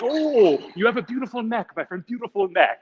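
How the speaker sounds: high, gushing compliment; flattering tailor. Retold or imitated. Tailor flattering customer to sell a shirt, high and gushing. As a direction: imitate a tailor laying on the charm.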